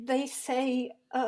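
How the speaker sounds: soft, faltering start; cowed and halting; timid mumbling. Acted. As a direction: hesitate nervously, too quiet to carry. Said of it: "Speak up, girl!".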